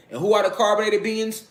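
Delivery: normal talking voice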